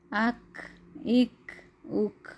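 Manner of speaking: اک with a slight whispered puff after the ک, said slowly; whispered k release. Shown as a demonstration. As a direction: let اک end in a soft whisper of breath. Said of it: "اس پہ بھی ہم تھوڑی سی whisper". (اک کو ہلکی سرگوشی کے ساتھ ادا کریں)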